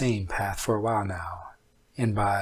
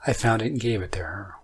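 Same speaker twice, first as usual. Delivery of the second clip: ordinary delivery, nothing marked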